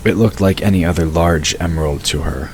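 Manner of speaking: ordinary delivery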